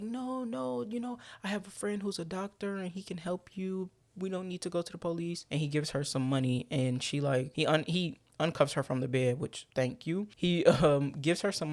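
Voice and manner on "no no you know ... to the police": mock soothing. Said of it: "And Chris is like".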